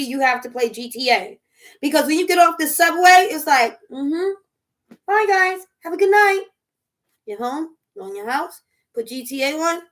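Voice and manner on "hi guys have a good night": higher, louder cheery send-off; lightly acted sing-out farewell; cheery goodbye. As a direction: play a chirpy goodnight to the group. Acts out friendly goodbye after subway.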